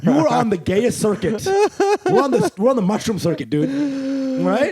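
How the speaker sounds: high, loud mocking trash talk; teasing bro voice. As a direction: rib him like a laughing friend. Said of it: "And, like".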